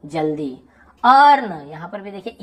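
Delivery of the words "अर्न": अर्न loud and drawn out, open vowel; EA pronounced as आ. Shown as a demonstration. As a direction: model अर्न with a long, raised vowel. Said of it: "ई ए का आ उच्चारण हो रहा है". (अर्न में ज़ोरदार आ उच्चारण)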